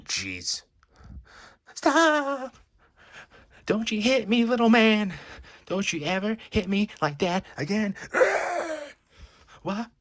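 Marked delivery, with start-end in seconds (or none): 1.7-2.5 sudden high, loud cry of stop. Acted. Hypnotized woman after the slap, high sharp cry. 3.7-7.9 angry warning. Acted. Woman turning on the man who slapped her, high and furious. 8.0-8.9 sharp high gasp of shock; high startled gasp. Acted. Woman snapping out of a trance, high gasp.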